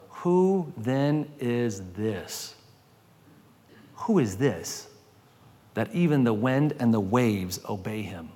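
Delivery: low, slow, hushed questioning; retold holy terror. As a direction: voice the stunned disciples in a low, slowed murmur. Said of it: "they were filled with terror".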